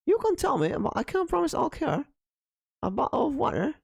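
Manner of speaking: high, exaggerated British accent. Shown as a demonstration. VTuber shows her British take on the line, British accent. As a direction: ham up a posh British accent for the chat. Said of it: "I gotta say it in British".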